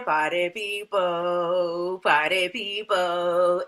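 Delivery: low, slow, hyped party call; slow party hype. Retold or imitated. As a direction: drawl it like a party host. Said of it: "She said".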